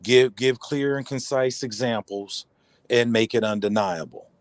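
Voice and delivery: measured, slow delivery; slow and deliberate. Real, not acted. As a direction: spell it out slowly.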